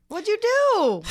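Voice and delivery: high, loud what'd you do; surprised. Real, not acted. Cohost reacting to him getting in trouble, loud and high. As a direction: jump up high in shock.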